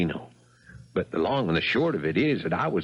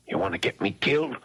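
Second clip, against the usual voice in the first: ordinary talk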